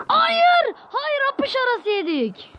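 high, slow despairing no; anguished no. Real, not acted. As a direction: cry out as the ball slips through. Goal conceded between the legs.

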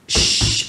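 drawn-out voiceless "shhh"; hushing shush. Retold or imitated. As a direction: shush the room before a speech. Retold hushing before a speech. (שטילער "שששש")

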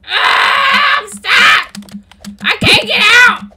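exasperated, high-pitched holler; playful distressed yell. Pretend. Gamer stuck in a pit cries out, distressed yell. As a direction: wail loud and high about being trapped.

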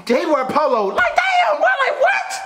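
very high-pitched mocking imitation of co-host; mock quote. Man mimicking co-host's reasons to smash, high-pitched mockery. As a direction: squeal it in mock amazement, far above your own voice. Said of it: "You just said".

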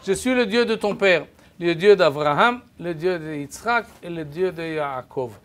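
normal talking voice

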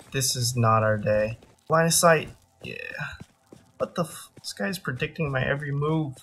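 ordinary delivery

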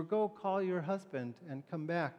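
usual speaking voice

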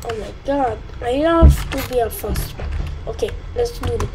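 usual speaking voice

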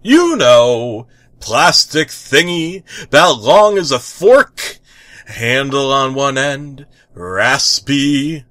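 loud, high and eager; enthusiastic. Acted. The tick cheerfully describing the scraper from the bath. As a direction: sing it back with gusto. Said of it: "enthusiastic voice sang back".

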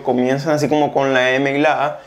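normal talking voice

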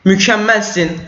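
normal talking voice

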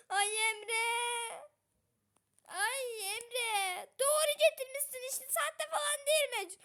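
high tearful frightened cries; frightened wail. Real, not acted. Wife wailing that the mouse was real. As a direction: wail to Emre in shock.

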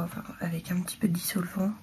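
quick whispered aside; fast whisper. Real, not acted. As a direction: rush it out in a whisper. Whispered aside while cleaning. (chuchoté rapide)

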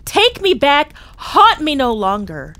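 loud, high, frantic begging; desperate pleading. A narrator's reading. Scrooge begging the ghost to leave him, desperate. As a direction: plead as if you can't bear it.

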